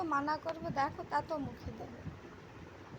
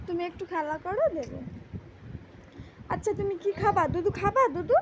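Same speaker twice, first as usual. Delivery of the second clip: put-on louder playful coaxing of a toddler; lively baby talk; 5 dB louder than usual. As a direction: tease and coax the baby brightly. Mother playing with baby. (বাচ্চার সাথে জোরে খেলাচ্ছলে আদুরে কথা)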